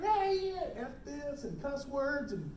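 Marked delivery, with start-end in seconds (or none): 0.0-1.4 mock screaming. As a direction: imitate a screamer without full volume. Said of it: "she was screaming, she was yelling".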